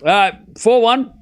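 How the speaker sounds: high, loud and slow, sulky; exaggerated disgruntled score read; unhappy reading. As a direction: moan the score like the match ruins your day. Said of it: "Like you were very unhappy with the match".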